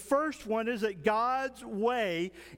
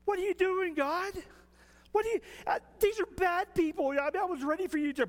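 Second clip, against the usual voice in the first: high, indignant whining protest; Jonah complaining; 6 semitones higher, faster than usual. Acted. Preacher voicing Jonah griping at God, indignant and high.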